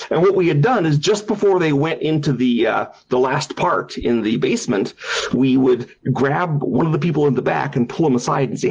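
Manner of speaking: plain reading voice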